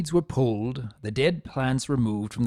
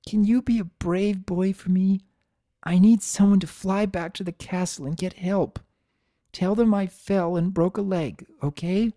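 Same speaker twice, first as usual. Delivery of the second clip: higher, coaxing mother voice; gentle mother; 7 semitones higher than usual. A narrator's reading. Injured mother asking her son to fetch help, coaxing. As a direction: encourage the boy to be brave.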